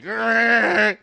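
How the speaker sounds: loud, high guttural retch; a mock gagging growl while throwing up; vomiting retch noise. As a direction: imitate a violent heave into the window. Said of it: "that made that noise".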